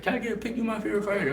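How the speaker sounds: high, eager fan voice asking for a photo; starstruck fan. Retold or imitated. Boxer imitating fans who asked for a photo, high and eager. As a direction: play the gushing fan meeting the fighter. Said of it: "they be like".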